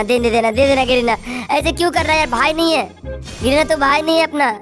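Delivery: normal talking voice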